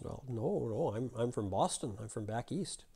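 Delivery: softly voiced meek little man; soft, deferential answer from a little guy; little fella. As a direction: lightly play a small stranger, quiet. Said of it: "The little fella says".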